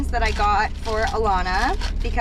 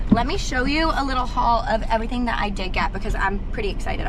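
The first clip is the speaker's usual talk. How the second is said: ordinary delivery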